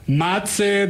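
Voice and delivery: usual speaking voice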